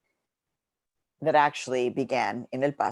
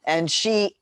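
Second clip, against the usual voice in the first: usual speaking voice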